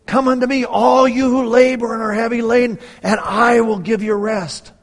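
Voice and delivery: loud, high, impassioned Christ's words; preacher speaking as Christ, at full force; full-force preaching. Acted. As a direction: proclaim it as a call to the weary. Voices Christ's invitation.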